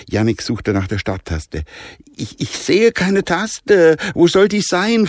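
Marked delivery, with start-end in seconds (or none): 2.2-5.1 much higher, halting and slow, flustered; panicky boy. Acted. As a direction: stammer in panic, can't find it. Jannik sucht panisch. (Jannik stottert panisch und hoch)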